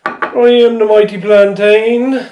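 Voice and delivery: slow, grand fake Irish proclamation; mock Irish accent. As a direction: boom it out as a jolly Irish leprechaun. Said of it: "happy little leprechaun".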